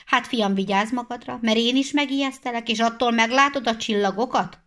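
head hunter scolding the reaper; stern warning. Acted. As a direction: warn the boy sternly. Head hunter threatens a boy.

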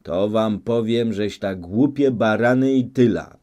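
loud, angry outburst; shouting. A narrator's reading. Grzela shouts down the doubting elders, angry and loud. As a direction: bark the insult at them. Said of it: "zakrzyczał".

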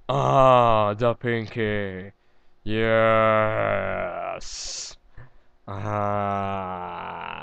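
playful fake trance; mock mystical. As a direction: intone it like a hammed-up mystic. Mock merging with Pinkie Pie.

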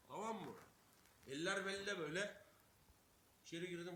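ordinary talk

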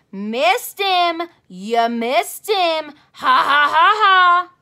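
loud, high mocking laugh; gloating jeer. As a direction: crow over her miss and laugh at her. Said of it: "said that Jim I hate".

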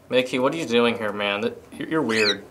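ordinary delivery

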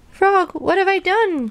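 high, drawn-out lament; distressed cry. A narrator's reading. Toad realises Frog has vanished, distressed cry. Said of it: "cried Toad".

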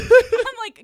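squealing laugh through the words; laughing loudly. Real, not acted. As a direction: crack up mid-sentence. Host laughing hard.

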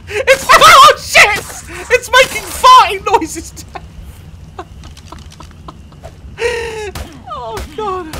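high, loud helpless laughter; laughing hysterically. Real, not acted. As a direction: crack up laughing. Creature makes farting noises.

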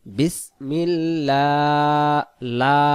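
বিসমিল্লাহ chanted slowly, লা held long; one-alif stretch. Shown as a demonstration. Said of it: "এক আলিফ".

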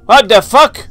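a real jolt of fright, loud and high; startled shout. Real, not acted. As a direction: yelp as the scare hits. Jump scare in horror game.

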